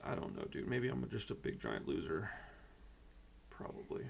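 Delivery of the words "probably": breathy resigned whisper; a whispered self-put-down; real whisper. Real, not acted. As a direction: let it slip out as a whisper. Despairing aside to viewers.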